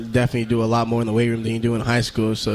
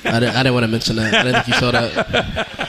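laughing while confessing; sheepish laugh through the words. Real, not acted. Caught hiding cheese sticks.

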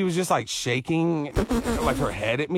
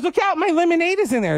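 put-on higher, bossy kid voice; little girl voice; 11 semitones higher than usual. Girl warning a dad off her lemonade, high kid voice. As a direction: play the toddler shopkeeper.

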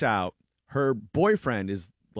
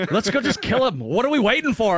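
eager thug; 10 semitones higher, 7 dB louder than usual. Retold or imitated. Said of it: "now he's like".

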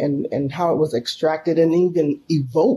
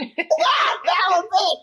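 laughing exclaim; 11 semitones higher, much slower than usual. Real, not acted. Climax of retold story, laughing.

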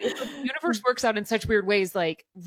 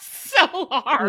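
loud, higher, through laughter; laughing; 6 semitones higher, 6 dB louder than usual. Real, not acted. Mother commiserating about the baby phase, laughing. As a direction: laugh out the agreement.

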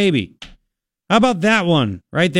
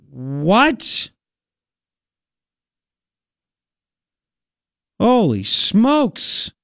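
slow, drawn-out amazement with raised pitch; very excited; 6 semitones higher, much slower than usual. Real, not acted. Host reading out great economic news, excited and amazed. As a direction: savor each word in excited disbelief. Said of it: "I got very excited about the economic news".

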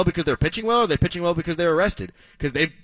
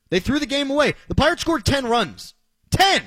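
exasperated; 10 semitones higher, 4 dB louder than usual. Real, not acted. Host outraged by a blown game.

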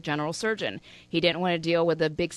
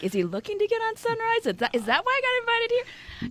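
higher, suspicious inner voice; mock suspicious thought. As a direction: voice her mock suspicion up high. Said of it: "I'm like".

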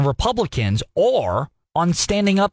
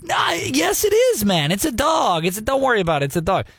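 higher mock insistence, brushing it off; mock Biden team; 8 semitones higher than usual. Biden team insisting a cat is a dog, higher mock dismissal. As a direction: play a spokesman waving away the obvious. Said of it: "And the Biden team goes".

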